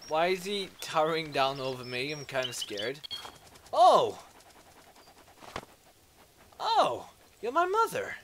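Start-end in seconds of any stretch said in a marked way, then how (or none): 3.7-4.2 high, loud oh of surprise; startled yelp. Real, not acted. Startled by towering figure. 6.6-8.1 very high-pitched, delighted discovery; surprised realisation. Real, not acted. Sudden realisation in game.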